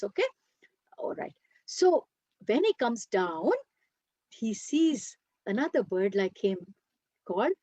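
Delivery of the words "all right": whispered aside. Real, not acted. Quiet aside before resuming.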